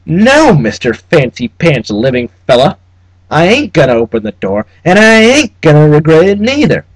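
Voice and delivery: put-on high, loud, twangy old-West bluster; a petty clerk laying down the rules of hell; blustering frontier clerk. Trubshaw defying Cabal.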